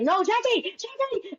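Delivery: high, urgent mock mother; pleading mom impression. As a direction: squeal the name to stop her leaving. Mom stopping daughter hanging up.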